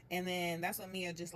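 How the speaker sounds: ordinary delivery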